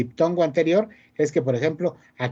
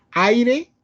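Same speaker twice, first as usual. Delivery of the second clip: "aire" loud and high, leaning on the opening A; stress on the A; 8 semitones higher, 5 dB louder than usual. Shown as a demonstration. Teacher shows students the strong vowel, stressed A in "aire". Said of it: "¿cuál es la fuerte ahí? La A".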